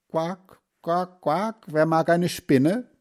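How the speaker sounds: quack, then offer a treat to the brood; mother duck. A narrator's reading. Mother duck offers ducklings a spider, quacking.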